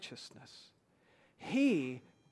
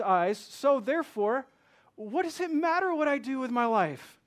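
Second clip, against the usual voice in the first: higher, louder mock objector asking what it matters; voicing a doubter; 6 semitones higher, 7 dB louder than usual. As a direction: play the challenging believer, voice rising. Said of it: "here's an issue we might have".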